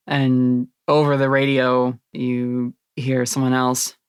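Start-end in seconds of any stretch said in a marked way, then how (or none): none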